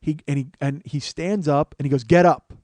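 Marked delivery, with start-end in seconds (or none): 2.0-2.5 loud, sharp order from a cop; retold police command. Officer orders a cuffed man to his feet, loud command.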